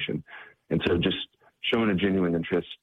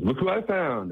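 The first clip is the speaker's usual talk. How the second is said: higher, pleased reveal; proud announcement; 10 semitones higher than usual. Retold or imitated. John presenting Rhonda to the missionaries, pleased and high. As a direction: show off who you brought.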